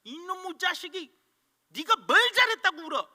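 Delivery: high, loud, fast scolding shout; angry father scolding. Retold or imitated. Father yells at his crying son to stop crying. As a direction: bark at the crying boy to stop. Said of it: "울고 있는 아들에게".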